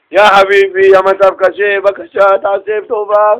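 hammed-up beggar's pleading, words drawn out; grovelling plea. Shown as a demonstration. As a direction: beg as if kissing his shoes. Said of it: "אתה צריך לרדת לרצפה". (תחינה מתרפסת ומוגזמת)

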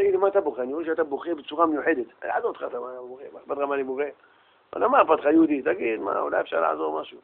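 very soft, low-key acted dialogue; hushed exchange. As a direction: voice a discreet approach to someone weeping. Stranger gently approaches the weeping man. (דו־שיח שקט מאוד ומאופק)

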